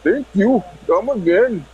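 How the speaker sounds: high-pitched mock Indian accent. Prank caller answers a call from India, Indian accent. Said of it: "It's from India".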